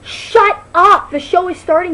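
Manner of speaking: shushing shout. Retold or imitated. Girl telling the loud latecomer to shut up, shouting. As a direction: yell at him to shut up. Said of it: "And I was like".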